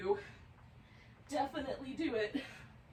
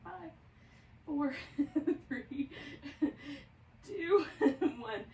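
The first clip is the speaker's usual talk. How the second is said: giggling through the countdown; laughing; 6 semitones higher, much slower than usual. Real, not acted. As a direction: laugh between numbers.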